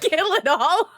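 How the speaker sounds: slow laughing, put-on villain; laughing mock order. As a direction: drag it out through a laugh. Echoes the mock policy, laughing.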